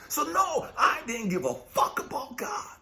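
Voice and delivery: high, loud outburst; defiant shout. Real, not acted. Preacher shouting his childhood defiance, loud.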